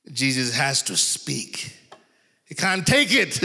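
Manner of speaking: usual speaking voice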